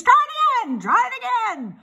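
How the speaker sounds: high, loud goose squawk; high-pitched cheering cry; goose crying encouragement. A narrator's reading. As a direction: egg the pig on in a shrieky goose voice. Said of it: "cried the goose".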